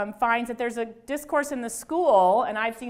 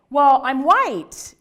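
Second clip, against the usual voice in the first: loud, very drawn-out mock answer; slow, loud, hammed-up hesitant admission; imitated awkward answer; 3 semitones higher, 11 dB louder, much slower than usual. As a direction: imitate a student stretching out the words. Imitating uncomfortable students' drawn-out answer.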